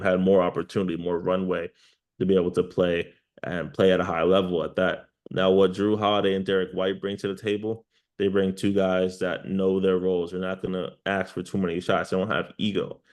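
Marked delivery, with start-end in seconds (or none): none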